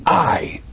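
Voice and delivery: "I" set apart and drawn out in a firm fall; emphatic stress on I. Real, not acted. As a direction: lean hard on "I", as God declaring himself. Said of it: "emphatic, it's God speaking".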